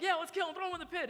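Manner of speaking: high, loud, gung-ho plotting; excited mock bloodlust; eager egging on. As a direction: play a brother all fired up for the plan. Brothers eagerly propose the killing.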